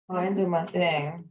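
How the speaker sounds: ordinary delivery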